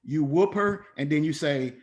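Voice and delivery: normal talking voice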